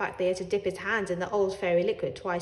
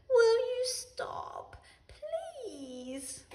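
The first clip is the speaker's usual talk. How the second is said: pleading whisper, slow; whispered plea; much slower than usual. A narrator's reading. Ellie gazing into Tuffy's eyes, slow pleading whisper. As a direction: breathe the plea right into its face.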